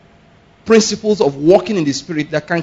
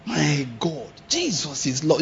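shocked praise; 8 dB quieter, slower than usual. Shown as a demonstration. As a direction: gasp out faith in shock. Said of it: "you keep on shouting it".

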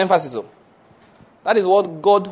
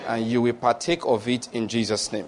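measured, rolling pronouncement of blessing; preaching blessing; 3 semitones lower than usual. Acted. Pastor pronounces blessings over the congregation, measured and rolling. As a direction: bless the flock with steady authority.

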